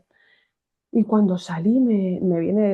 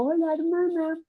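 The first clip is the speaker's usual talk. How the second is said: lightly voiced mother, higher; a touch of a friendly mum calling out, pitched up; mother greeting; 10 semitones higher, 4 dB quieter than usual. Mother greeting the nun through the crowd, lightly voiced and higher.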